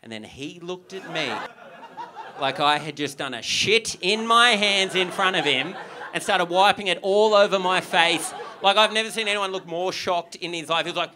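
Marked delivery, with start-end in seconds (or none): none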